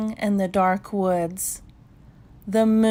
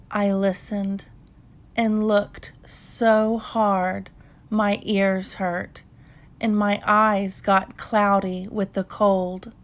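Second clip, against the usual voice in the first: ordinary delivery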